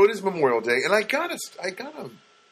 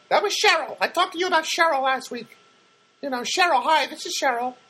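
bright Cheryl voice. Retold or imitated. Imitating Cheryl greeting him, high and chirpy. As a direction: chirp like a cheery therapist.

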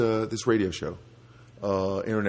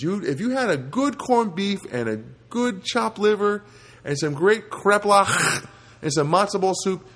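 ordinary delivery

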